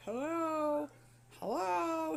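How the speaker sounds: high, loud drawn-out call; imitated yelling. Older patient calls from his bed for a nurse, imitated yelling. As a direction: call out like an old man wanting attention. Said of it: "He kept yelling".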